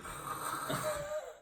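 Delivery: voiceless drawn-out breath noise; long rasping breath, no voice; mock weird snore. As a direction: imitate an unconscious friend's odd snore. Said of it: "he's just going".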